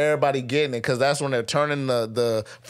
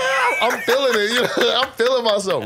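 high excited laugh, feeling himself; crowing with delight through laughter; bursting with pride and laughing; 8 semitones higher, 3 dB louder than usual. Retold or imitated. Proud, laughing while drilling.

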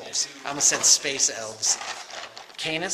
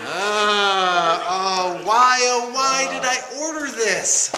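higher, louder mock wail of regret; mock despair; 6 semitones higher, 6 dB louder, slower than usual. Seller regrets his own over-ordering, in a high mock wail. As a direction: groan it out like a theatrical lament.

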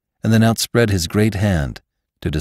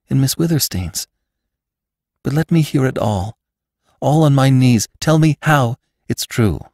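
put-on pleading young woman; 6 semitones higher than usual. Bess pleading, high.

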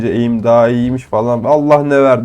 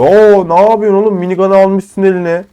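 higher, louder startled outburst; surprised; 6 semitones higher, 5 dB louder than usual. Real, not acted. Gamer spotting a minigun enemy, startled shout. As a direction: caught off guard by an enemy.

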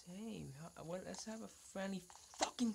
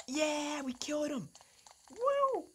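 loud, high victory cheer; triumphant cheer after the kill; 9 semitones higher, 6 dB louder, slower than usual. Real, not acted. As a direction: whoop at the win. Celebrating a kill.

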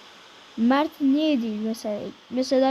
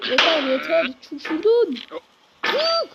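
frustrated yell; 4 semitones higher than usual. Real, not acted. Gamer yelling at stuck character to get out. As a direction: snap at him, ending in a shrill command.

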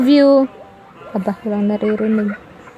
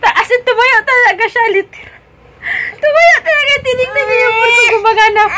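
excited retelling; 11 semitones higher, 5 dB louder than usual. Real, not acted. Retelling baby's upset, laughing.

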